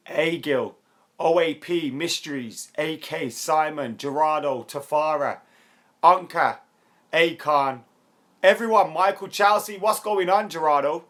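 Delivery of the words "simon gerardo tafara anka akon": ordinary talk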